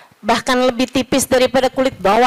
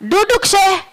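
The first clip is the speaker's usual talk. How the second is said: high, indignant rebuke; scolding; 7 semitones higher than usual. Retold or imitated. Man in the mosque scolding the sheikh, high and indignant. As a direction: scold him for his bad manners.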